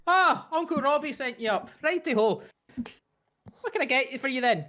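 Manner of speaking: high, chirpy Irish lilt; young Irish shopkeeper voice; Irish twang. Acted. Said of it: "She's a bit more of an Irish twang to her voice".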